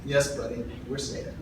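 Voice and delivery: put-on very soft, choked-up words to a toddler; a hushed, tearful reply to his little son; holding back tears. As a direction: fight back tears as you speak. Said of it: "holding back tears".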